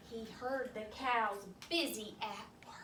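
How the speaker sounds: soft hushed narration; whisper. A narrator's reading. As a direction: lean in and whisper.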